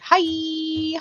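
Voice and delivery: bright, high, sing-song hello; mock chirpy hi; cheery greeting. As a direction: greet everyone like a host opening the show. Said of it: "that's how they all opened".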